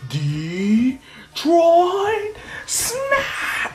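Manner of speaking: drawn-out high mock battle yell; hammed-up superhero finishing shout; joint attack cry. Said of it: "they both say".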